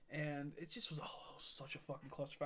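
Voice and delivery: very soft whispered exasperation; whispered aside. Real, not acted. Fed up with the girl's drama, whispered under the breath.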